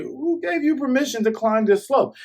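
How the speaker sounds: high mock villain challenge; voicing Kuro. Kuro demanding why they climb the slope, high mock voice. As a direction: squeak it up as the snooty captain.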